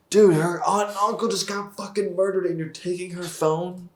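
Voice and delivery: loud, high, indignant rant at the screen; exasperated outburst. Real, not acted. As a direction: blow up in disbelief at the character. Ranting at the show's script.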